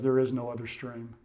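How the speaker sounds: low, hushed lion's answer; solemn pronouncement. Acted. The lion answers the thirsty girl who would seek another stream, solemn and hushed. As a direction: say it softly and low, with finality.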